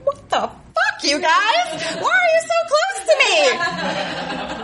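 high, stretched alarmed protest; freaked out. Retold or imitated. New skater panics as teammates crowd her, high alarmed squeal. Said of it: "I'm like".

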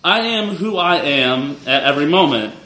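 ordinary talk

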